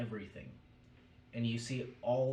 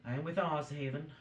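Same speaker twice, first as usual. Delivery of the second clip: higher, louder character reply; Tabaxi voice; 6 semitones higher, 5 dB louder than usual. Acted. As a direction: speak up in a raised, put-on voice. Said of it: "You see the Tabaxi".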